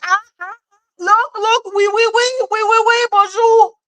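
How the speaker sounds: high-pitched silly parody of foreigners speaking French; mocking bad French speakers. They say one word in French, high mocking imitation. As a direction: squeal out clueless tourist French. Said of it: "and they're like".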